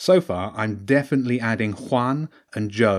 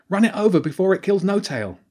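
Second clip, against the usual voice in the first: high, loud and pressing; urgent shout; 10 semitones higher, 4 dB louder than usual. Acted. Jenny urging Annabelle to run the creature down, high urgent shout. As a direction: yell at her to hurry. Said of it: "Jenny shouted".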